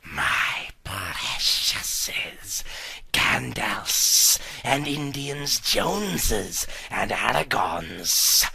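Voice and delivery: low, slow, whispery Gollum hiss. Acted. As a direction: creep and hiss over the precious. Gollum voiced in story.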